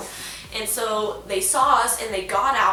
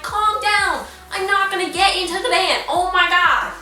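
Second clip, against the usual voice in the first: high, loud fed-up outburst; exasperated yelling; 8 semitones higher, 6 dB louder than usual. Retold or imitated. Daughter tells dad to back off, yelling in exasperation. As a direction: yell like an exasperated teenager at dad. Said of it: "I just yelled it at him".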